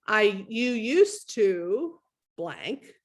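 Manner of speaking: very slow, every word weighted; magic phrase, slowly. Shown as a demonstration. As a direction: lay out "I, you used to blank" slowly and deliberately. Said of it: "This is the magic phrase".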